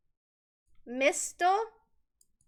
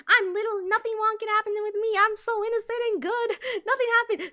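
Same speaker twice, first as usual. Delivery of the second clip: higher, sing-song goody-two-shoes voice; mock innocent; 7 semitones higher than usual. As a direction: sell it like a little angel who can do no wrong. Plays the innocent little girl.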